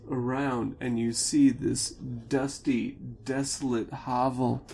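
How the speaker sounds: normal talking voice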